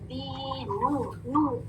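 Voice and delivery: slow दीनू with long "dee" and "noo"; rounded "noo". Shown as a demonstration. Teacher showing the vowel, slow rounded "oo". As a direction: hold दीनू softly, lips rounded on नू. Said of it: "rounding भी है और double ऊ sound".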